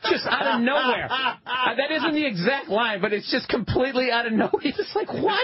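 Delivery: cracking up in disbelief; laughing. Real, not acted. Laughing while retelling the bad movie line, amused.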